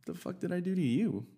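mock-offended what-did-I-do; indignant disbelief. Boyfriend reacts to girlfriend's mom's nasty look, mock indignant.

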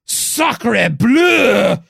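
loud, high mock French outrage; French accent. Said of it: "people in France are gonna be like".

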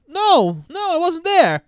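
high, loud retold denial; mock emphatic denial. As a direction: deny it loudly in a jumped-up pitch. Acted lie when confronted by Jane.

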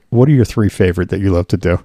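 ordinary talk, nothing marked

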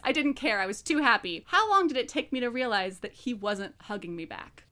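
soft, earnest reading of a passage; reading aloud. Acted. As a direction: read it softly, like a novel. Reads book passage aloud.

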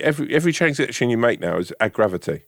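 usual speaking voice

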